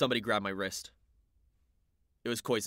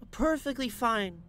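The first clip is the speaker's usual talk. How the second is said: put-on high, slow, unsteady girlish voice; tipsy girl voice; 4 dB louder, slower than usual. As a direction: drag out perfectly fine like a tipsy girl. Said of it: "looking anything but perfectly fine".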